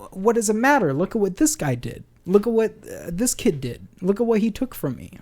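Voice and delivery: high-pitched mock child protest; imitating protesting kid. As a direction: plead your case like an indignant kid.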